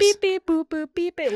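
high, loud beeping droid; robot beeps. Retold or imitated. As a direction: beep like a little droid. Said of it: "in his beep boops".

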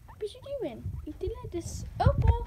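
high-pitched cooing to a pet; pet talk. Real, not acted. Owner asks Opal what she's doing, high pet-talk voice. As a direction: talk way up high to a little animal.

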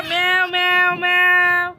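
put-on high, drawn-out meows for a baby; slow high meowing to a crying toddler; slow kitten meows. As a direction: stretch each meow out to distract her. Meowing slowly to distract child.

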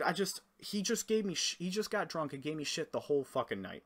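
ordinary delivery

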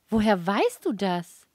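loud, excited question with a leap in pitch; agitated. A narrator's reading. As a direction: burst out asking, stretching the words. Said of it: "Sie war aufgeregt".

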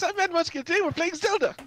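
high put-on Irish-style intro; cheery high fake Irish voice; mock Irish intro. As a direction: copy the silly accent.

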